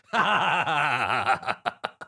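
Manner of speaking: drawn-out villainous cackle; evil laugh. Acted. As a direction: laugh in triumph over your victim. Villain finishes gloating.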